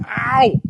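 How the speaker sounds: loud, high sudden ow; pained yelp. Real, not acted. Sudden pain exclamation.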